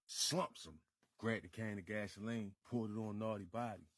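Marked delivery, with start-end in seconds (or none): none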